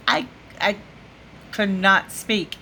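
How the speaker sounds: ordinary talk, nothing marked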